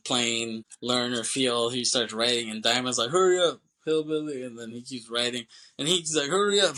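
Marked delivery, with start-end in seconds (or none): 3.1-4.4 higher, louder mock order; impatient hurrying. Diamond rushing Poe as he writes, impatient. 6.3-6.8 high, loud retold nagging; impatient hurrying. Diamond giving Poe no time, impatient=.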